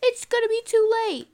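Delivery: high, loud mock alarm; hammed-up cry of dismay; mock panic. Acted quote of panicking character.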